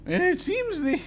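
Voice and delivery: very high-pitched, composed noblewoman; high, proper duchess voice. Acted. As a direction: make a polite request in a high put-on voice.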